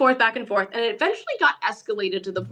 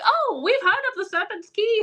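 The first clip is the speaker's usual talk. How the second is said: high, put-on voice of a starstruck fan; mock overseas fan; 12 semitones higher than usual. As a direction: imitate an eager stranger abroad. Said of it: "They're like".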